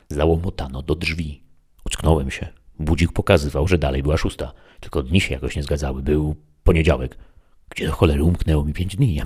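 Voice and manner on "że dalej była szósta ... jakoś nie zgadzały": ordinary delivery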